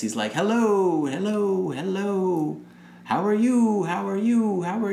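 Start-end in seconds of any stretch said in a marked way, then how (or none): 0.3-2.6 high calls, each hello falling away; yelling into the abyss. Retold or imitated. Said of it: "he just yells into the abyss". 3.0-4.9 yelling into the abyss. Retold or imitated. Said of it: "he just yells into the abyss".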